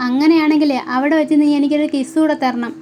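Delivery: ordinary talk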